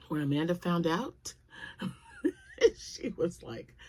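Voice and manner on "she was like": laughing through the words; giggling as she tells it; words squeezed out through a laugh. Real, not acted. Laughing while retelling.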